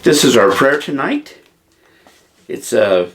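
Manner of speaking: normal talking voice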